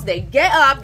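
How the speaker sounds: normal talking voice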